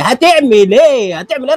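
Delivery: drawn-out high taunt. Real, not acted. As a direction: drag out هتعمل ايه as a rising taunt. (هتعمل ايه ممطوطة بنبرة عالية ساخرة)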